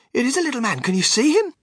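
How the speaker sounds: put-on high-pitched eager child; excited cry. A narrator's reading. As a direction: call out in astonished excitement. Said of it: "cried Charlie".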